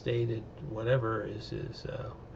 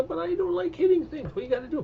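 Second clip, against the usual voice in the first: very high, meek little voice; timid Jurati; 4 dB louder than usual. Pretend. Jurati refuses to hit the robot toy, very high and meek. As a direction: squeak it like you hate violence.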